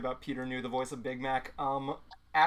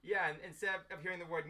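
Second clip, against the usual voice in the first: ordinary delivery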